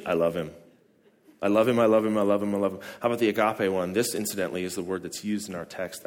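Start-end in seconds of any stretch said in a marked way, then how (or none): 0.0-2.8 repeated flat English. Shown as a demonstration. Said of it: "you know what we say in English".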